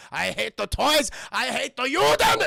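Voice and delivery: loud, hammed-up tyrant's declaration; hateful villain. Burgermeister ranting about what he hates, loud villain voice. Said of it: "as he once said".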